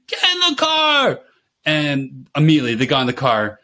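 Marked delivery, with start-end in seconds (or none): 0.1-1.2 high, drawn-out parental shout; raised-voice command. Retold or imitated. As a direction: holler it to herd the kids. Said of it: "I just raised my voice".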